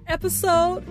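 ordinary talk

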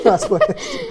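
high, laughing words; laughter takes over the line. Real, not acted. Laughing as he jokes about respect from his friend.

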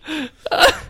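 helpless high-pitched laugh breaking out; burst of high laughter. Real, not acted. A man cracks up reading eighteen identical pickup lines, high helpless laughter. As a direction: crack up at the repetitive list.

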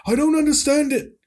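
put-on high, loud cranky old timer; grumpy old man voice. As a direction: rant like a cranky grandpa. Grumpy old person rant.

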